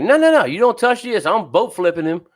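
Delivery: high, firm mock of the fisherwoman; imitating Irene. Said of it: "She's like".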